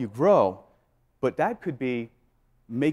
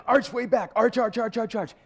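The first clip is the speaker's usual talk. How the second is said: urgent self-coaching; 9 semitones higher than usual. Retold or imitated. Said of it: "I said".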